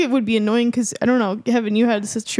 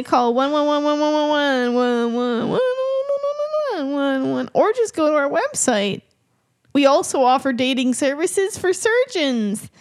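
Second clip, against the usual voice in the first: mock commercial; 4 semitones higher than usual. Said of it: "We're sponsored".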